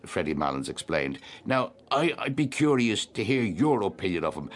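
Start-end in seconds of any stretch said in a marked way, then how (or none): 1.4-4.5 higher-pitched voice, stumbling over the start; eager, hesitant supper guest. Acted. Guest giving his opinion of a singer, eager and halting.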